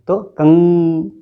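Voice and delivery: कंग drawn out slowly through the nose; कंग held with nasal vibration; slow nasal demo. Shown as a demonstration. Teacher demonstrates to pupils, slow nasal कंग. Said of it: "vibrations नाक में हो रहे हैं".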